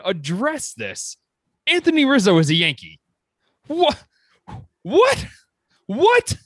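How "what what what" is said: rising squeaky stunned what; can't believe the news, voice leaping up; shocked disbelief. Real, not acted. Fan reacting to surprise trade news, stunned high what.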